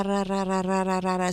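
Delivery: ra, ra said softly with the tongue tip on the palate; ra repeated with a trilled R; rolled R demo. Shown as a demonstration. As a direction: tap out a soft rolled ra, ra. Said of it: "had to be a ra".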